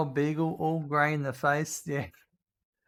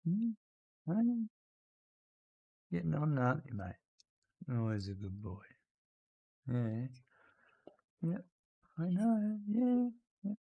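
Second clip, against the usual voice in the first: soft pet talk; 6 dB quieter than usual. Real, not acted. Owner cooing softly to his old dog Randy, tender.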